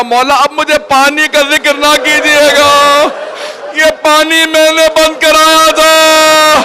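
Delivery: high, slow, tearful lament; weeping plea. Acted. Hur begging his master, in a slow high wail. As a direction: let the guilt pour out in a slow high wail. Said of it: "جناب حر رونے لگے".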